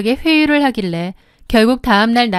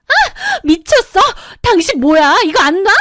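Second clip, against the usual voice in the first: shrieking in pain and rage; 8 semitones higher than usual. A narrator's reading. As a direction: scream while your hair is pulled. Hair grabbed, screaming back. (머리채 잡혀 비명 지르며 소리치는 목소리)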